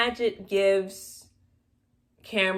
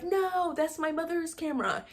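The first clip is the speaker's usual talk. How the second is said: much higher, pleading cry of dismay; mock upset Cameron; 9 semitones higher than usual. Vlogger acts Cameron seeing his mother's camera thrown, higher mock dismay. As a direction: squeak out the protest in mock distress. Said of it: "Cameron is like".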